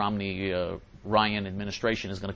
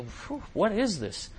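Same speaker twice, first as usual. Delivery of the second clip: slow, higher, puzzled reaction; mock bewilderment; 9 semitones higher, slower than usual. Host recalls hearing this as a child, mock bafflement. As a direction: act out baffled disbelief.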